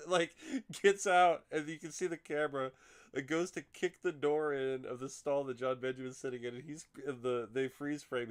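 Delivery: high, loud, breaking into laughter; laughing while telling. Real, not acted. Retelling the stall chase to a co-host, laughing hard. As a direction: tell it while cracking up.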